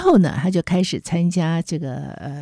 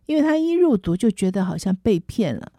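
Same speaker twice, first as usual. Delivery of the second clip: ordinary talk, nothing marked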